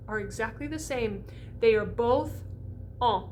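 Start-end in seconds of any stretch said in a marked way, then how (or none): 3.0-3.2 en as a nasalized ah; nasal French en. Shown as a demonstration. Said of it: "both a nasalized A".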